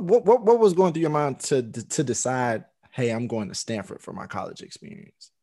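ordinary delivery

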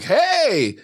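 high, drawn-out mock "hey"; retold call-out. Parent to child walking right past, high imitated call-out.

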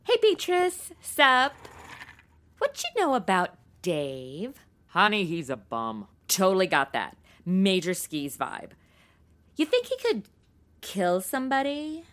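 put-on gossipy girl voice. [name] gossiping with the neighbour about Dave. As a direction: chat up the witness like a gossiping friend.

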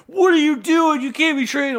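high-pitched mocking alarm; mock panicked leaguemates. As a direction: squeal it like horrified leaguemates. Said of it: "I was like".

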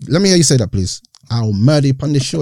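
ordinary delivery

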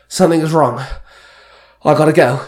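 a touch of gasping urgency; gasped. A narrator's reading. Husband telling his wife he must leave, lightly gasped. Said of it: "Danny gasped".

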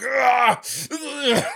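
high effortful grunts; mock straining groan. Exhausted swimmer hauling himself up a boat ladder, straining groans. Acts climbing ladder with no energy.

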